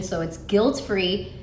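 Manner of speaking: ordinary talk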